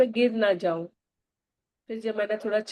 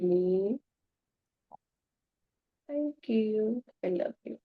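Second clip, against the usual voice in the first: slow, soft, drawn-out thank-yous; guided gratitude meditation; 3 dB quieter, much slower than usual. Acted. Guiding listeners to thank their mother, slow and soft. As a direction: breathe each word out gently as in meditation.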